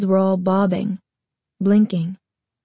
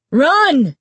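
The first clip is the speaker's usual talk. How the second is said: high, loud warning shout; yelling; 7 semitones higher, 5 dB louder than usual. Acted. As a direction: yell at her to flee. Shopkeeper screaming warning.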